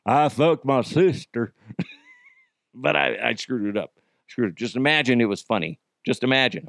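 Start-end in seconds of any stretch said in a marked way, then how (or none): 0.1-1.5 hammed-up dopey Arkansas accent; dim Arkansas yokel. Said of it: "It was supposed to me be going".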